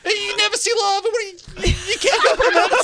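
high, laughing mock guide. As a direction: laugh through the guide's disbelief.

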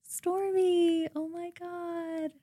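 very soft, slow American influencer voice; quiet, languid celebrity drawl; Kylie Jenner. Shown as a demonstration. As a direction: purr a hushed, drawn-out vlog intro. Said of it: "Kylie Jenner".

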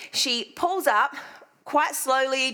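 usual speaking voice

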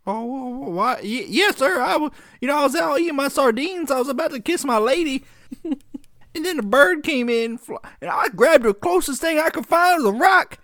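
high, stammering country bumpkin excuse. Pretend. Acts accused man before judge.

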